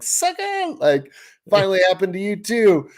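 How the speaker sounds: high-pitched retold taunt; mock gloating. Angler to friend who snapped his line, gloating in fun. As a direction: crow it high, teasing a buddy's bad luck. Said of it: "laughing 'cause I'm like".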